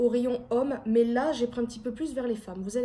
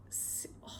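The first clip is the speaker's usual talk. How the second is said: awed whisper; 18 dB quieter than usual. Real, not acted. Admiring the fabric of a top.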